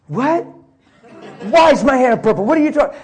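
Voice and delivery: high, loud, baffled outburst; loud, high angry comeback from a young man being told off; mad retort. Retold or imitated. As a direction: snap back in offended disbelief. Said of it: "he got really mad".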